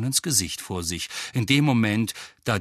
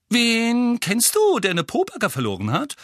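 curious; 12 semitones higher, 5 dB louder than usual. A narrator's reading. Violet-haired witch asking about the lost Pobacke, curious. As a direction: pipe up nosily with a high voice. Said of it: "fragte die Frau mit den violetten Haaren neugierig".

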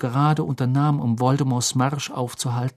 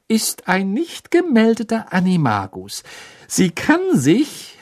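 higher, slower, barely held-in glee; suppressed triumph; 7 semitones higher, 3 dB louder, slower than usual. Acted. Hermine revealing Rita's secret to the boys, trembling with triumph. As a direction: savour the secret you finally get to tell. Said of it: "zitterte verhaltener Triumph".

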